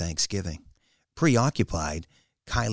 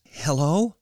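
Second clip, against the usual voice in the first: mildly nervous, higher and louder hello; 9 semitones higher, 5 dB louder than usual. A narrator's reading. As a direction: answer with a touch of nerves. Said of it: "was a nervous".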